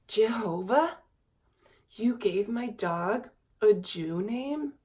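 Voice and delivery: slow mock-indignant disbelief; imitating indignant boyfriend. Retelling King Chad's reaction to the dog's name, mock indignation. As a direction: play up his offended outrage. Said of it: "He goes".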